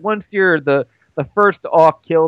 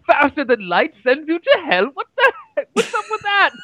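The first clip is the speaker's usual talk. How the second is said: put-on much higher British-accented voice; baffled British protest, pitched way up; Albert Einstein; 11 semitones higher than usual. Co-host answering as a physicist on Event Horizon, squeaky British bafflement. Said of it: "I like that your Einstein's British".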